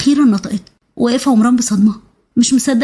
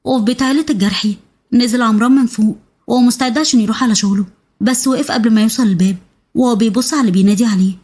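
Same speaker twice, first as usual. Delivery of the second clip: ordinary delivery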